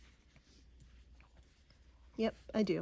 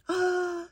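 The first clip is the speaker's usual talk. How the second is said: sharp delighted intake of breath; excited gasp; 10 semitones higher than usual. Real, not acted. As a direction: gasp at the fabric you love. Excited about library fabric.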